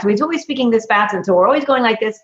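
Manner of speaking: demonstrating a too-fast pace; rushed fast talk. Shown as a demonstration. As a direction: rattle the words off quickly. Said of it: "One is his pace was this fast".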